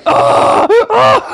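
loud, high "oh!" cries; acted shriek. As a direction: cry out two big gasping ohs. Grito actuado al contar una anécdota. (grito agudo actuado)

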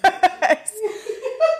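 a burst of high-pitched laughter; high squealing laugh. Real, not acted. Friend laughing at a tiny shoe size, high squeal.

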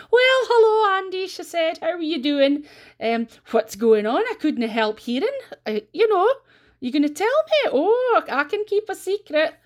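high, loud eager gossip; nosy gossip voice. Retold or imitated. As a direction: gush like a nosy neighbour. Gossip Missus Mackenzie voiced.

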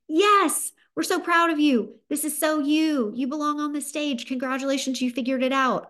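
put-on high, excited parents cheering on their kid; proud parents. Parents congratulating their daughter on choosing theater, excited and proud. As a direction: gush with pride, voice lifted high. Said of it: "My parents were like".

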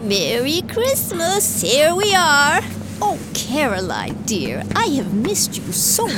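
cheery holiday greeting. Acted. Grandmother greeting the family at the door, high and cheery. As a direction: sing out your arrival at the door.

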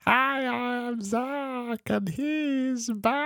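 very high, very slow squeaky imitation; slow high-pitched mock voice; Zach's voice. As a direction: put on a tiny high voice, drawn out. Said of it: "He talks like this".

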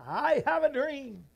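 loud, high, drawn-out oratory; ringing orator's call; Martin Luther King Junior. Retold or imitated. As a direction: proclaim it slowly like a rousing speech. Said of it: "Martin said".

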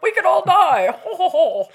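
high, loud chuckling jolly adult; jolly laughing. Retold or imitated. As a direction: laugh it off merrily. Jolly mock laughing quote.

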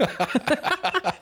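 "Epic" in a very high squeak; squeaky epic. Acted. Echoing "epic" in a silly voice.

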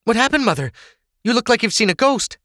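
high-pitched startled concern; alarmed question. Acted. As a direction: ask your mother what went wrong, alarmed. Ava startled by mother's shock.

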